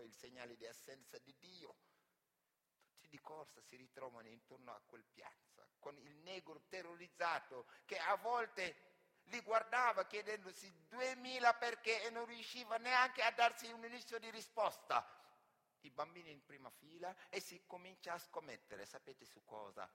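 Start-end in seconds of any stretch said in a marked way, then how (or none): none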